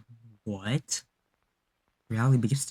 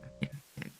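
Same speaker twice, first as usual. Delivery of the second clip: terrified whisper; 4 semitones higher, 12 dB quieter than usual. Real, not acted.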